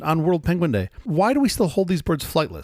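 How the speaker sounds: usual speaking voice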